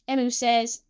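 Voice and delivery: usual speaking voice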